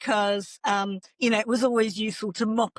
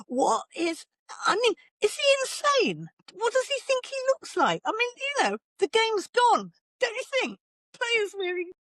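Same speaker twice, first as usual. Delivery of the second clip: very high-pitched squeal of disbelief; incredulous outrage; 13 semitones higher than usual. Real, not acted. Pundit appalled by a player's Alice band, incredulous squeal. Ranting in disbelief about headband.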